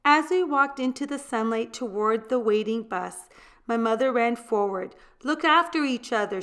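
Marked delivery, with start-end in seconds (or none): 5.2-6.4 higher, quick urgent call. A narrator's reading. Mother calls to her departing children, higher and urgent. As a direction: call after the children as they leave. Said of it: "she cried".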